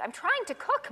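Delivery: high frazzled plea; a frazzled mother's cry; exasperated mom. Acted. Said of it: "Mom said".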